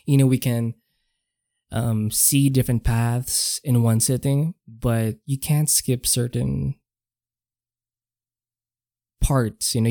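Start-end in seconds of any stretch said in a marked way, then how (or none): none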